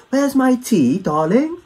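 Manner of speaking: high, hammed-up posh English lady; mock posh British accent. Londoner telling Americans what they imagine, a high hammed-up posh British accent. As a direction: trill it like a caricature of the Queen. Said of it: "That whole, like".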